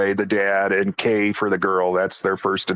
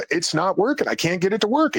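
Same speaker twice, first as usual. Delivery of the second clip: pitched up high, flustered; rising panic; 8 semitones higher than usual. Retold or imitated. Telling Jim the goggles died, high and flustered. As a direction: sound baffled as the gear dies. Night vision failing, panicking.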